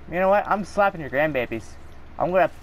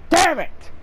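high, sharp frustrated outburst; real rage snap, pitched way up; angry curse; 11 semitones higher, 4 dB louder than usual. Real, not acted. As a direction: spit it out in annoyance. Frustrated gamer dies.

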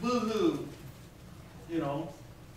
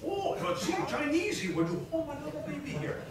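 mock Chinese accent, pitched higher and softer; 8 semitones higher, 4 dB quieter than usual. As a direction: lightly put on Chinese accent, pleading.

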